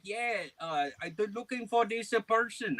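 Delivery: high, put-on caller voice; imitated scam caller.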